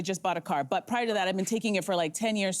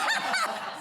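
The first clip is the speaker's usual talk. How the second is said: high laugh. Real, not acted. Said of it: "frog in my throat".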